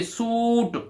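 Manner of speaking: "सूट" with a drawn-out oo; long oo sound. Shown as a demonstration. Teacher showing the double-O sound, "सूट" held long. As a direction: stretch the vowel in "सूट". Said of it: "double ओ की फिर ध्वनि आ रही है".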